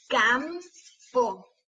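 "Campo" slowly as cam, pause, po; split into syllables. Shown as a demonstration. Teacher showing lips closing on M, syllable by syllable. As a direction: break "Campo" between its two syllables. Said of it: "para ver cuáles de esas sílabas".

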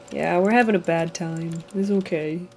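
usual speaking voice

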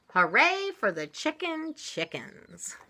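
high, drawn-out storybook cheer. A narrator's reading. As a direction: cheer for the brave little chickens. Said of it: "cried all the animals".

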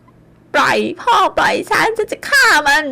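slow, high, screamed threat; raging scream. A narrator's reading. Drunk daughter screaming at her father to release her. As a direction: scream to be let go. Struggling to attack husband.

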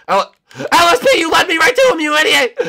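high, loud screech of blame; furious high yell; outraged yelling. Real, not acted. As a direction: scream at the teammate who betrayed you. Yelling at teammate in chase.

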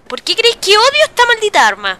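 loud, high indignant question; exasperated rant. Real, not acted. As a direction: demand it in gamer frustration. Explains hatred of the weapon.

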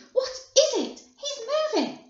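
put-on high, loud child's squeal; screams of excitement. A narrator's reading. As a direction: shriek each discovery as it comes. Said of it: "screams of excitement".

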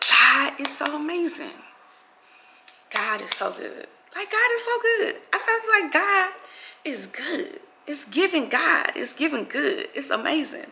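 real emotion, softening and slowing; moved praise, voice swinging high then dropping soft; emotional. Real, not acted. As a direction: overcome with gratitude, drawn out. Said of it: "I'm emotional".

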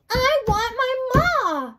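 very high, slow, drawn-out wail; crying out. Acted. Mig wails her wish into the waiting world, crying out. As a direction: bawl it out like a lost child. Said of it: "cried Mig into the silent waiting world".

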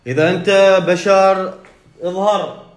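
high, loud yell daring the spirit to appear; loud scared dare; frightened shout. Pretend. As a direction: shout a shaky challenge at the jinn. Shouting challenge again.

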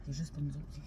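hushed confiding. Real, not acted. Secret told to camera, hushed.

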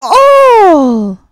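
high, loud squawking seagull demanding its lunch; a bossy gull shrieking for its food; greedy seagull voice. Acted. Seagull grabs the cake.

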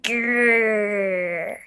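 low growling demo; wolf growl. Shown as a demonstration. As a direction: show how a wolf growls. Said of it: "Wolves growl. Like this".

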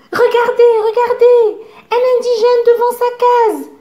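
delighted by everything; marvelling cry. A narrator's reading. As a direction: blackbird marvelling at each sight. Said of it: "s'écrie Zigomar qui s'émerveille".

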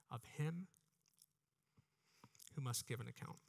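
ordinary talk, nothing marked

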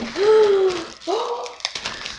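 sharp high gasp of delight; excited gasp. Real, not acted. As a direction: catch your breath at the surprise. Finding another polish.

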